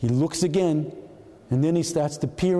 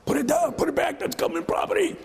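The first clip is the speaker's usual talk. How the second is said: much higher, half-joking shout at the screen; mock yell. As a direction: bellow it like a knucklehead agent. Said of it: "we're yelling".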